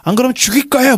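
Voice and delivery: high-pitched, hammed-up threat; angry threat. Tao trapped behind the iron door, angrily threatening Walt. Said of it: "막 욕을 하면서".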